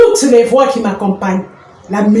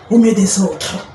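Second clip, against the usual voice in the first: normal talking voice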